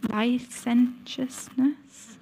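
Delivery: licentiousness sounded out very slowly, syllable by syllable; careful stumble. Real, not acted. Reading scripture to the congregation, slowly sounding out licentiousness. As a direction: crawl through licentiousness like a word never seen before. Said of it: "I've never heard of that".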